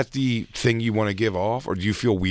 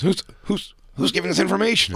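high-pitched suspicious demand; mock paranoid questioning; 9 semitones higher than usual. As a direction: pounce with exaggerated suspicion. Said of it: "He goes".

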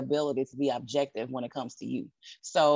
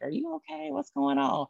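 high anxious fussing voice; worried loved one; 11 semitones higher than usual. Retold or imitated. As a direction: fret like a worried relative.

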